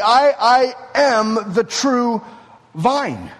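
slow, loud, high stress on "I, I am"; emphatic. Acted. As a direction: proclaim "I, I am" with full weight, then land on "vine" after a pause. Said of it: "he says emphatically".

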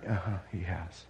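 ordinary talk, nothing marked

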